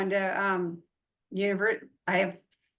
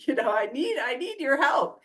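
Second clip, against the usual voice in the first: mock crying; 7 semitones higher than usual. Client begging her mentor for help, mock tearful. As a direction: whine it like a teary client. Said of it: "I'm crying".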